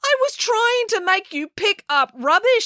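high, slow, insistent; indignant high-pitched quarrel; exasperated retort. A narrator's reading. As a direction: argue back, stretching each word. Heated quarrel after a dunking.

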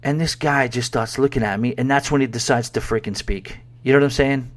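ordinary delivery

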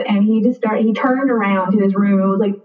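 ordinary delivery, nothing marked

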